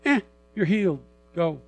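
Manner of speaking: mock-dismissive healing; offhand. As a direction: toss it off as if inconsequential. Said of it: "it's almost like it's inconsequential".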